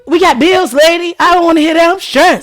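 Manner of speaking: high, loud venting at the lady; mock-furious, stressed about bills; retold anger. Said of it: "I'm pissed off".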